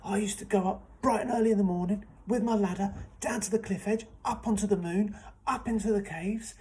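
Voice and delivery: loud, high, eager storytelling; excited telling. A narrator's reading. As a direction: grab them with excitement. Excited storyteller opening tale.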